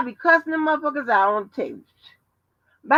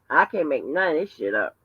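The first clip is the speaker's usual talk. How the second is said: ordinary talk, nothing marked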